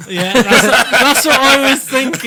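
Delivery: laughing loud and high; words bursting through laughter. Real, not acted. Cracking up at the wheeze.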